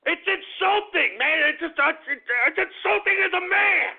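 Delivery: high, loud, slow indignant rant; boiling over, drawing out every word; real indignation rising into a yell; real outburst. Real, not acted. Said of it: "I'm going off keister on this".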